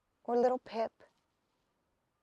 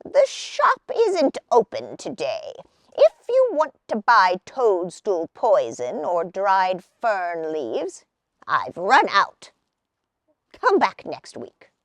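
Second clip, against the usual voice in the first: put-on lower, gruff old shopkeeper; grumpy old man; 5 semitones lower, 4 dB louder, slower than usual. A narrator's reading. Old man turns Hazel away, lower and gruff. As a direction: shoo her off like a cranky old man.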